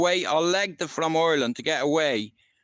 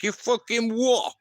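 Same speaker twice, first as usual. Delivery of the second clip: retold angry retort; 9 semitones higher than usual. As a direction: throw it back at her, half shouting. His retort to the shouting neighbour.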